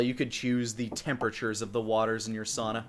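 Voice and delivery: ordinary delivery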